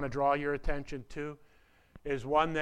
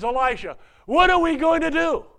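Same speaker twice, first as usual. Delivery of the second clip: a desperate shout, far higher and louder; panicked despair; 10 semitones higher, 10 dB louder than usual. Retold or imitated. Servant begging Elisha for help against the army, panicked shout. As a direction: cry out to Elisha in sheer panic. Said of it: "in a fit of despair".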